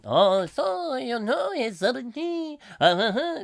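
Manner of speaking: high, hammed-up French mumble; mock French gabble. Host mimicking French broadcaster bleeding through, mock French. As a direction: fake French babble. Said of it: "some French dude talking".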